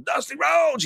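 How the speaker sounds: high shouted promo call; Ric Flair shout. Retold or imitated. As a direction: bellow the name like Flair cutting a promo. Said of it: "Flair just starts talking".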